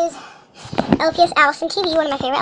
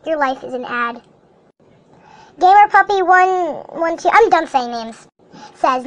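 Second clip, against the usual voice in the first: ordinary talk, nothing marked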